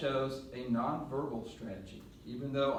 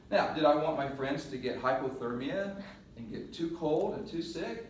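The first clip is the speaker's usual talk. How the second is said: usual speaking voice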